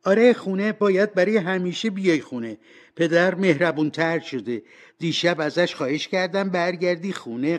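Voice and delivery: put-on high, excited child's voice; joyful, eager reassurance; happy little girl. A narrator's reading. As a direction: bubble over with good news, gradually settling. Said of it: "دختر بچه با خوشحالی گفت".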